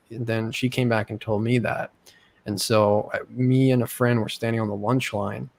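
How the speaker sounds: ordinary talk, nothing marked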